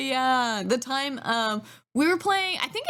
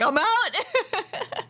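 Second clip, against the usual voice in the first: mock bail out; 9 semitones higher than usual. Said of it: "D M was like".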